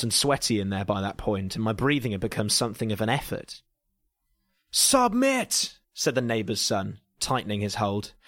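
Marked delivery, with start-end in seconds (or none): 4.7-5.8 high, strained wrestler's scream; screamed command. A narrator's reading. Neighbour's son tightening his headlock on the narrator, high screamed command. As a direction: scream it like a hammy American wrestler. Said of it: "he screamed in a poorly articulated American accent".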